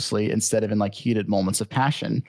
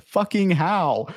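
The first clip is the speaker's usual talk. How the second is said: higher-pitched mock tirade; exasperated scolding; 5 semitones higher than usual. Uncle scolding his nephew over the captured bison, exasperated. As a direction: scold in disbelief, as an acted quote.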